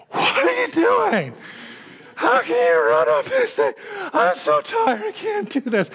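high, breathless tired woman voice; winded woman on hill. Retold or imitated. Tired jogger on the hill, complaining to the preacher, high and breathless. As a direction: gasp it out like a spent hiker. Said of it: "And she goes".

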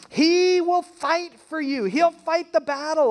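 high, loud, slow proclaiming; preaching at full force. Real, not acted. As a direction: ring it out like a promise from the pulpit. Preacher proclaiming God fights.

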